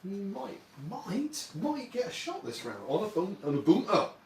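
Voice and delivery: put-on high, sing-song Geordie accent; a quick burst of Geordie accent. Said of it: "don't know why I went Geordie there".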